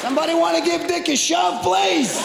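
high, strained mock shout; exasperated calling out. Countdown watcher calls for help.

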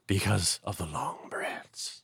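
slow hushed whisper; whispered punchline. Acted. Wilson confessing his bread craving in a slow whisper. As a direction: let it drop to a conspiratorial whisper.

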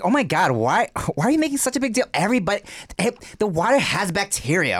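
a hint of a high, defensive whine; mock defensive. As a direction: push back like she's the victim. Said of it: "She's like".

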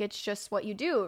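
normal talking voice

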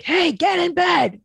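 slow yell up high into the monitor. Retold or imitated. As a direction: bark it slowly into the baby monitor. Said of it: "I'll be like".

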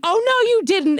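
high, loud scandalized imitation; sassy neighbor in mock outrage; super sassy outrage. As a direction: exclaim it with sassy affront.

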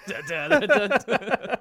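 laughing scat. Pretend. Jingle while laughing.

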